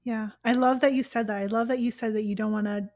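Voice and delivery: ordinary talk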